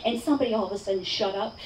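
usual speaking voice

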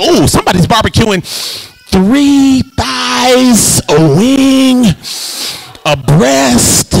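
high, drawn-out sampling of the smell; amazing barbecue sniffer. Acted. Churchgoer showing off a nose for neighbor's barbecue, savoring. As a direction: draw out each item as you identify it. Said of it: "Some of y'all is amazing".

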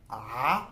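"आ" rising upward; second tone demo. Shown as a demonstration. Teacher modelling the rising second tone for students. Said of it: "second tone".

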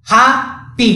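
ହାପି slowly split, "haa - pi"; syllable-by-syllable demo. Shown as a demonstration. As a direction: pause between the two syllables of ହାପି. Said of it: "ମଝିରେ ବନ୍ଦ ହେଲା".

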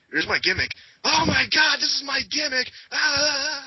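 high, loud, hammed-up frenzy; over-the-top manic imitation of an eager performer; going nuts with it. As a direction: squeal it in mock hysterics, ending on a scream. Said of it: "they go nuts with it".

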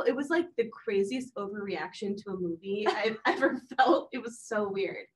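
normal talking voice

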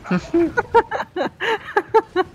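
high, rapid giggling; real run of high laughter; laughing. Real, not acted. Gamer cracking up at a friend's nickname, laughing.

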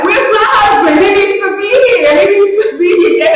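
calling for her husband in a panic; retold frantic cry mid-labor; frantic demand.

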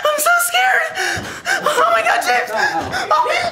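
high, panicked and near tears; terrified, voice climbing with fear; scared. Acted. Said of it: "I'm kinda scared".

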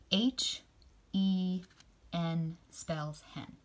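put-on slow, drawn-out spelling for kids. Shown as a demonstration. Teacher modelling a spelling, said slowly. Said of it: "Can you say that with me".

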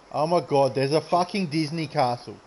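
ordinary talk

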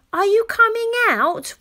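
high, loud call through the door. Acted. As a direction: shout to his sister in the bathroom. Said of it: "Rory called".

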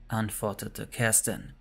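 plain reading voice

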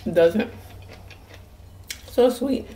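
talking while eating; chewing corn. Real, not acted. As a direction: mouth full of food. Seen 0: Bites corn off cob, then chews with closed, working lips.

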